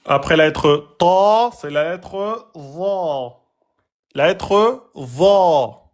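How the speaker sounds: very slow teaching line; slow and deliberate. Shown as a demonstration. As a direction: draw za out slowly. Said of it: "C'est une lettre emphatique".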